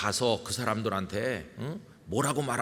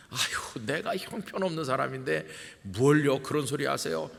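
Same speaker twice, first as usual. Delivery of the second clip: modest refusal; 4 semitones higher than usual. Retold or imitated. Deacon modestly declining role.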